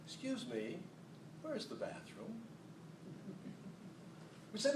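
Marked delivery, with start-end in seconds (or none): 0.0-2.3 soft, polite little request; polite quiet ask. Retold or imitated. Mother asking politely and quietly for the bathroom. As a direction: ask gently like a shy tourist. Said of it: "She would smile and say".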